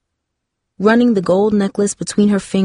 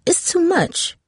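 put-on girl voice; 11 semitones higher, slower than usual. A narrator's reading. Darcy voiced, higher.

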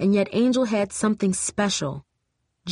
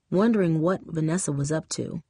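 ordinary delivery, nothing marked

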